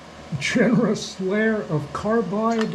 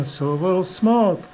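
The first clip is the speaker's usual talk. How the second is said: ordinary delivery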